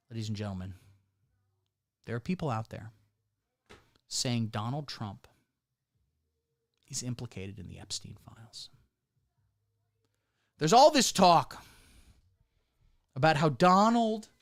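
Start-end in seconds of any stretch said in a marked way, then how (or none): 0.1-8.7 grave hush. Acted. Mock grave announcement.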